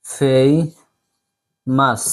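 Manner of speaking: ফেমাস drawn out very slowly as fay-mas; slow model pronunciation. Shown as a demonstration. Teacher asks the class to repeat, very slow. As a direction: stretch ফেমাস so students can copy it. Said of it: "আমার সাথে বলেন".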